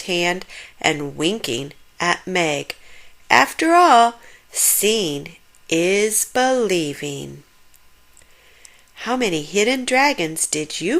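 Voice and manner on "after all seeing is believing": slow, knowing final line; boy echoing grandfather's saying, slow; knowing close. A narrator's reading. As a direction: land the moral slowly. Closing wise line.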